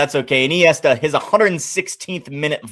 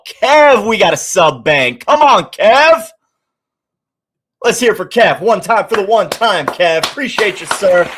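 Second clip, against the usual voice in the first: excited shout-out; 8 semitones higher, 6 dB louder than usual. Real, not acted. Shouting out a chat subscriber.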